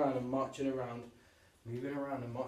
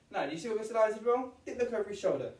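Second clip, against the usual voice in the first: mock scolding; 9 semitones higher, 6 dB louder, faster than usual. Teacher mock-scolding himself.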